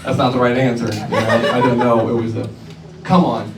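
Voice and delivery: low, grumbling mock woman; mock sulking reply; annoyed woman. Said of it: "she's like".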